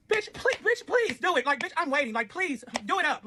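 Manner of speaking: very high, sassy taunting dare; mocking dare. Narrator daring the ex to do it, very high mocking dare. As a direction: egg her on with sass.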